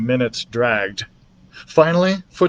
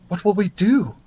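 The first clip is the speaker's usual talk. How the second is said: worried mother; 7 semitones higher than usual. A narrator's reading. Mother voiced.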